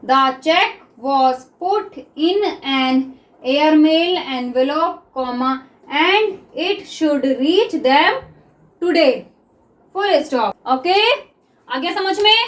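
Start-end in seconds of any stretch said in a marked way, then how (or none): 0.0-10.5 sentence read very slowly, phrase by phrase; teacher's slow read-aloud; slow reading demo. Shown as a demonstration. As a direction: read it slowly for dictation practice. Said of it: "अब इसको हम read करते हैं".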